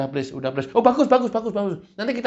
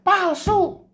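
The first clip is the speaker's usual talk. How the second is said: a high, snappy devil's verdict; high mocking devil; 11 semitones higher than usual. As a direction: pitch it high and dismiss it. Devil's reply voiced.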